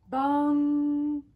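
a loud, long ringing bong; clock bong. Acted. Narrator sounding the noon clock bong. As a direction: ring out like a clock striking noon.

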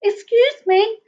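high voice at full volume; high, audible polite interruption; repeated plea. A narrator's reading. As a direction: pipe up clearly to get attention. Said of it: "said Basil again".

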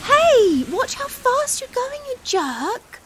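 high, loud furious yell; angry shout at a driver. Acted. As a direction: bellow after the speeding car. Yelling at a passing driver.

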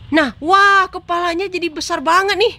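high, loud burst of amazement; amazed outburst. Real, not acted. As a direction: exclaim at a startling sight. Baby's head suddenly turns huge.

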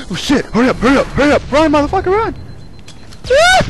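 hurry-up screaming at the screen; frantic excited yelling. Real, not acted. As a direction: scream your runner on in a panic. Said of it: "I started screaming a little bit much".

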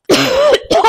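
sharp real cough from inhaled dust. Real, not acted. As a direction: cough as if dust caught your throat. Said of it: "I think I breathed in some dust then".

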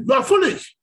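high, loud mocking rebuke of a stranger; angry Lagos bus stop retort; angry insult. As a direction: snap at him in Yoruba-flavored pidgin. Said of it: "They say".

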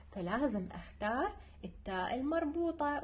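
ordinary talk

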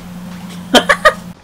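high squeaky cackle; real staccato laugh. Real, not acted. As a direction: burst out in a high giggle. Laughs after donut complaint.

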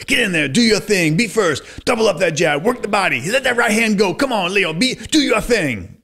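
high, pushed-up ringside coaching calls; a trainer barking from ringside, pitched high; ringside trainer calling. Retold or imitated. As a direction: shout encouragement at a fighter from the corner. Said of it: "Basic kind of, little coaching".

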